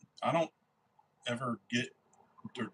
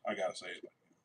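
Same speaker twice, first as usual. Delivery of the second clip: usual speaking voice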